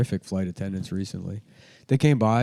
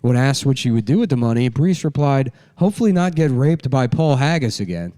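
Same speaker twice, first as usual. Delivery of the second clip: late-night monologue; 5 semitones higher, 8 dB louder than usual. Pretend. Mock monologue joke delivery.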